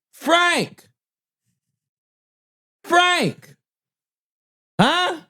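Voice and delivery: high shouted call for Frank. Retold or imitated. Counter lady yelling to the back for Frank. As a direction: holler across the stand for Frank. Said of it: "The lady goes".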